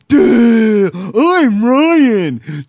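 high, loud dopey caricature; mocking, exaggerated doofus impression; Ryan. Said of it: "I'll do my best Ryan impression".

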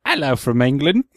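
bad English accent drifting toward Australian; amateur mock-English accent; attempted English accent. Shown as a demonstration. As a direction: read it in a put-on English accent. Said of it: "can you read it in an English accent".

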